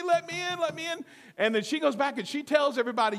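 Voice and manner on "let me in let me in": high-pitched call to be let in; mock yelling. Peter outside to those indoors, mock yelling. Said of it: "guess who's still standing outside yelling".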